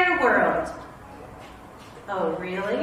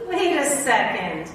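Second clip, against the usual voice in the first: usual speaking voice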